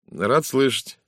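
understated disappointment behind politeness; sour. Acted. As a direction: say it with a forced, thin smile. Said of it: "кисло улыбнулся актёр".

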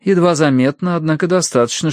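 ordinary delivery

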